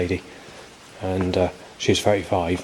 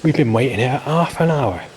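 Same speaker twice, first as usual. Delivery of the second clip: higher-pitched mock complaint; imitated TV lady; 5 semitones higher, 4 dB louder than usual. Lady at the children's ride complaining to Mister Bean, imitated complaint. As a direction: mimic the complaining lady from a comedy episode. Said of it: "it's similar to that accent".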